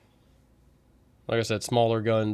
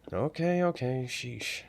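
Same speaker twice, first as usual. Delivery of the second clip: a drawn-out, grudging okay, okay, slow and fading softer; sulky grumble; 2 semitones higher, 3 dB quieter, slower than usual. Acted. Wayne giving in sulkily after Torres scolds him, slow grudging drawl. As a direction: roll it off like a scolded kid, slow.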